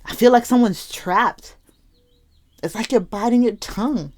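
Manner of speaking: real emotion lifting the pitch; getting emotional. Real, not acted. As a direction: speak as if feeling someone else's trapped frustration. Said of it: "Sorry, I'm getting emotional".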